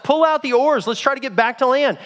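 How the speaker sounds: high-pitched urgent command to the crew; shouted order. Acted. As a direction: call the order out to the sailors. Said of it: "So he shouts out".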